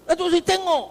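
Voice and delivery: high, insistent youth's call; imitated shout. Youths urge the Datuk Seri to look, calling high. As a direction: plead for a look from afar. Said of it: "dia jerit".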